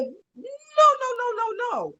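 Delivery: retold panicked string of refusals; alarmed protest. Wife told her husband is going to rehab, alarmed protest.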